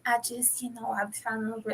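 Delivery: soft, quick mock voice; imitating her. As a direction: murmur her words in a put-on tone. Imitating the woman.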